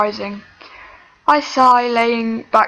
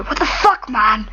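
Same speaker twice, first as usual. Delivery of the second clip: growling. A narrator's reading. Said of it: "he growls".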